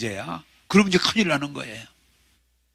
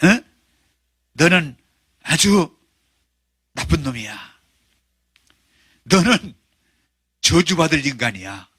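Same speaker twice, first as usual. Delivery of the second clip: devil whispering accusations; 4 semitones lower than usual. Acted. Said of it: "쏘살그는데".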